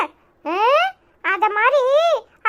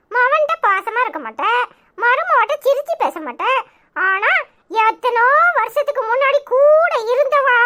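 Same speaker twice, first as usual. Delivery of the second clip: normal talking voice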